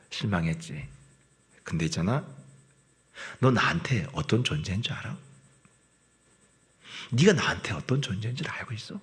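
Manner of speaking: quietly reassuring, near a whisper; God's tender reply. Acted. God's gentle reply voiced.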